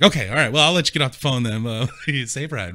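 high, loud, suddenly chipper; perky mom. Retold or imitated. Mother on the phone, suddenly chipper once her pain pills are on the way. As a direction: bounce it up like relief just hit. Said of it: "she pepped right up".

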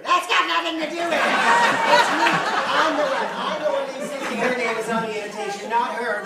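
loud, high shouted protest; furious outburst. Acted. Fiancée defending her stepmother to him, furious.

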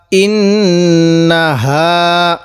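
ইন্নাহা with a long held nasal নন্না; slow Quranic recitation with ghunna. Shown as a demonstration. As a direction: hum the doubled n through the nose in ইন্নাহা. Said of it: "এজন্য আমরা গুণনা করব".

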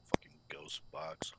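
muttered curse in a whisper. Real, not acted. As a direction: grumble it under your breath. Annoyed aside at broken box.

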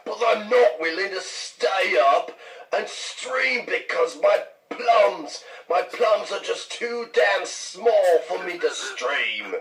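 high, stretched-out fake British accent; exaggerated British impression, dragging the key words; mock British accent. American teasing a friend over his streaming, mock British accent. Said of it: "I think I have your accent down perfectly".

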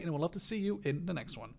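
ordinary delivery

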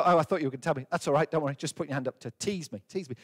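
ordinary talk, nothing marked

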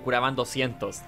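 usual speaking voice

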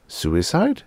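ordinary delivery

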